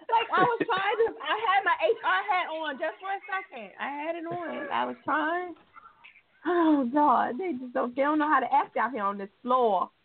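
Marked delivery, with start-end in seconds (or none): 0.1-5.5 high laughing whine; whiny laughing. Real, not acted. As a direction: protest through laughter.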